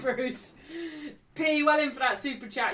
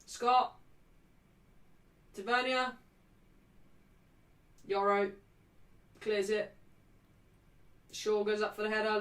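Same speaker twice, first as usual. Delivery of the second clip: usual speaking voice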